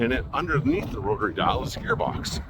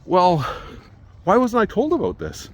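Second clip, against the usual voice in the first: exasperated; 9 semitones higher, 4 dB louder than usual. Retold or imitated. Angry retold phone call.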